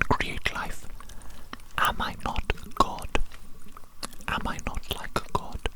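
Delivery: normal talking voice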